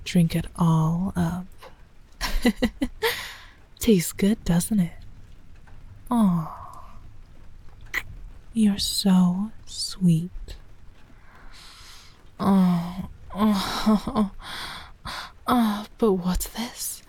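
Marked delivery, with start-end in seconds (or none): 2.2-3.3 soft giggle. Acted. Soft giggle during roleplay. 14.1-14.7 soft giggle. Acted. Soft giggle during roleplay.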